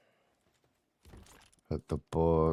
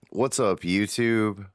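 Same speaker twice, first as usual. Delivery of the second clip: loud greeting; 6 semitones higher, 8 dB louder, slower than usual. Real, not acted. Streamer greets his chat loudly.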